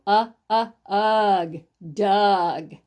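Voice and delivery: isolated short "uh" sounds, then "ugh" blended into "Doug". Shown as a demonstration. Teacher shows short U to young readers, sounding out. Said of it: "It's got a short U".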